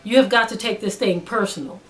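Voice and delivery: ordinary talk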